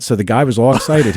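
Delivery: ordinary talk, nothing marked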